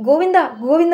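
put-on loud, high call after the boy; father calling after his fleeing son. A narrator's reading. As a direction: shout his name as he runs off.

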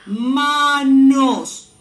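"manos" loud, split ma-nos; palabra por sílabas. Shown as a demonstration. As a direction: stretch each syllable of "manos". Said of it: "formar la palabra". ("manos" fuerte, separado en ma-nos)

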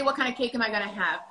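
ordinary talk

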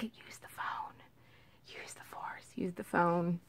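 hushed whisper to herself; telling herself to use the phone, whispering; whispered reminder. Retold or imitated. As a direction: coach yourself under your breath.

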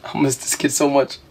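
ordinary delivery

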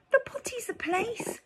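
very high proud princess; delighted proud princess; proud high exclaim. Acted.

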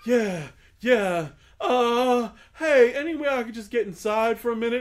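imitating a freezing man, high and loud; retold freezing man. Freezing man begs storyteller to let him inside, loud high retold plea. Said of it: "he's freezing, and he's like".

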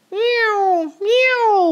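high, loud meows; meowing cat. A narrator's reading. Cat meowing while chasing mice. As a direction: meow like a cat. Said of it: "went the cat".

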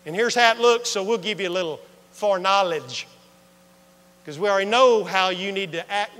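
loud, rising preacher delivery; preaching. Acted. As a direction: preach it to the congregation. Preaching at full force.

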